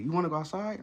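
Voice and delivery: high, eager rising question; excited pet-owner pitch, lifting at the end; enthusiastic. Shown as a demonstration. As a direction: get the dog all excited. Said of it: "I'm saying it, like, enthusiastically".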